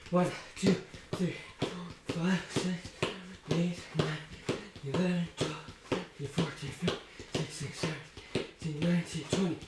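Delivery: out of breath. Real, not acted. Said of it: "quite out of breath actually".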